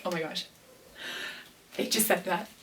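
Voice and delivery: quietly stunned, re-enacted; hushed, dazed retold reaction; stunned disbelief. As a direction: say it softly, as if barely believing the news. Stunned reaction to winning the title.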